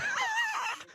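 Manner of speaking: high squealing burst of laughter; excited shrieking laugh. Real, not acted. Hears themselves on the radio.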